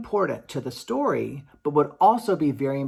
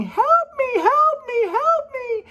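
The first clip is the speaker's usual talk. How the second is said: very high, loud panicked cries; harp screaming for help; 7 dB louder, slower than usual. Acted. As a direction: shriek it like a terrified captive harp. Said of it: "the harp started screaming".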